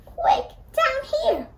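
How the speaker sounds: usual speaking voice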